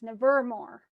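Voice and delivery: misplaced accent on VER in Nevermore; wrong stress. Shown as a demonstration. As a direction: punch the middle syllable of Nevermore. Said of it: "it sounds like an, a, a different word".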